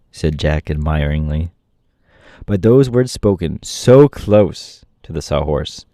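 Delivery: ordinary delivery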